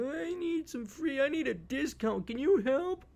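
high, slow whiny entitled voice; mock a whining freeloader; high nasal-free mocking whine begging for a discount; whiny customer. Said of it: "Do not be a baby boomer arp member douche thinking".

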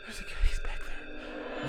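hushed whisper. Acted. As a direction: whisper it so he won't hear. Warning friend secretly.

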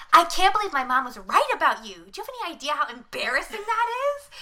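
mock indignant girlfriend; scolding girlfriend. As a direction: scold him like an embarrassed teen. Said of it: "she's like".

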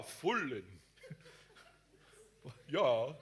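usual speaking voice

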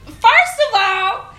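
high, loud, drawn-out indignation; retold indignant comeback. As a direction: act out putting a youngster in his place.

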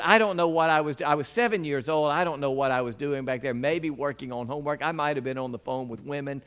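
ordinary talk, nothing marked

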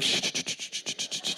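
rapid hushed camera-click noise; mouthed burst of camera clicks; imitated camera shutters. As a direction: make a string of soft shushing shutter sounds. Imitates cameras circling in slow motion.

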